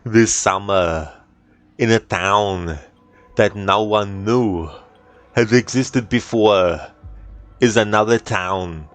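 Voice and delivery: slow, dramatic movie-trailer narrator; Arnold trailer voiceover. Retold or imitated. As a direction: drag out every phrase like an epic trailer. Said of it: "if Arnold was a trailer voiceover guy".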